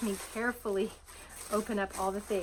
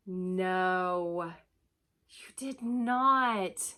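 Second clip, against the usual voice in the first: slow, louder, drawn-out disbelief; delighted disbelief; 5 dB louder, much slower than usual. Real, not acted. Vlogger to the friend who sent the deck, slow disbelief. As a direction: stretch it out in happy shock.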